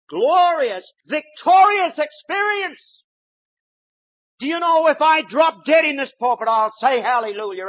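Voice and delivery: loud, high, fervent preaching; full-force preaching. Acted. Preacher exhorting his congregation, loud and fervent. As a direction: rouse the whole congregation.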